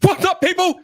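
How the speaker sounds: high, shouted announcer intro with stretched-out words; hype show opening. Acted. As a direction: belt out a live show welcome with long whoops. Performs the show's opening.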